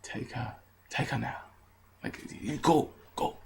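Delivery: hushed plea. Retold or imitated. Begging the drunk girl's friends to take her, hushed. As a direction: quietly beg them to take her away.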